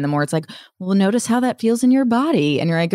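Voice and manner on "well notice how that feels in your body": therapist quote. Retold or imitated. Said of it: "the more therapy that I've done".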